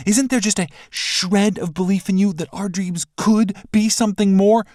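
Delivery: high, loud insistent plea; pleading woman. Acted. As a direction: press him to believe. Bashira pleads in argument.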